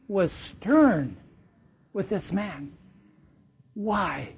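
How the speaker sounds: ordinary talk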